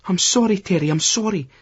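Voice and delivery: pleading. A narrator's reading. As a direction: plead with him desperately. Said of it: "Lucy's pleading with him now".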